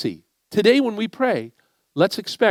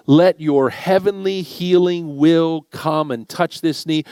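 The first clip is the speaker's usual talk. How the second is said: lower, slow, earnest prayer voice; 4 semitones lower, slower than usual. Acted. Pastor praying over Matt's knee, slow and earnest. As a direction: pray it slowly, laying hands on.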